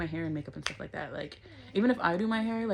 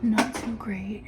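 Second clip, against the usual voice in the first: whispering; 3 semitones lower, 6 dB quieter than usual. Real, not acted. Whispering count in club.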